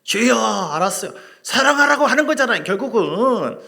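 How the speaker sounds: loud, high surrendering cry; loud prayer. Retold or imitated. Pastor answering God after hearing his voice, loud cry. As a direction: shout the surrender, slowing down. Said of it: "소리 지르다 보면 하나님 음성이 들리거든요".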